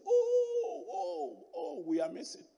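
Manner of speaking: sudden high "Oh" then dropping; the driver realises he's lost; surprised realisation. Retold or imitated. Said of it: "He said".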